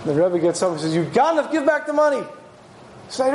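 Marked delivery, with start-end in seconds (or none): 0.9-2.2 higher-pitched retold rebuke; stern accusation. Rebbe gets up and accuses the ganav, sternly. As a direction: accuse the thief, voice lifted. Rebbe confronts the thief in story.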